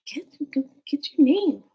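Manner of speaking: high, urgent whisper; imitated stage whisper. Friend urges getting the name, imitated urgent whisper. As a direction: nudge someone along in a forced whisper. Acting friend urging from the side.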